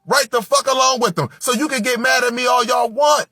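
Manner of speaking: loud, high-pitched street sermon, fired up; fiery street preacher shouting truths at the crowd; preaching at full force. Real, not acted. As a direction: preach it at them hard, no letting up. Ranting sermon to listeners.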